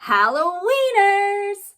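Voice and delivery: high, loud, stretched-out punchline; shouted punchline. Acted. Ghoul answering own hot dog riddle, loud gleeful shout. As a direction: belt out the joke's answer.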